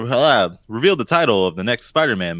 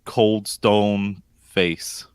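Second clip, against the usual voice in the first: deadpan; 3 semitones lower, much slower than usual. Acted. Describing his deadpan face.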